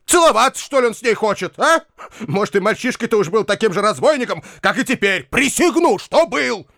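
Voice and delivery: high-pitched, irritated rant; furious. A narrator's reading. Bakhcheev is bursting at Foma's nonsense, furious. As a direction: sputter with indignation, rising to an outburst. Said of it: "готов был лопнуть от гнева".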